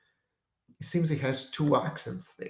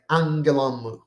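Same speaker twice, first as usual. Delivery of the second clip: "Angelon mu" joined, accents on an and lon; two accents, one word; 4 dB louder than usual. Shown as a demonstration. Teacher showing combined words, two accents and mu unstressed. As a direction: run "Angelon mu" together, leaving mu unstressed. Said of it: "with two accents".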